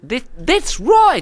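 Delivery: very high, slow Kiwi confirmation; New Zealand accent. Acted. Host confirming the topic, New Zealand accent. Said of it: "you can do the New Zealand accent".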